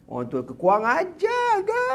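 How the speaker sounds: high, slowed mocking sneer of a raging elder; jeering high old-man voice; angry old man. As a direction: squeal it high and slow, a scornful old bully. Abu Jahal rages at Abdullah.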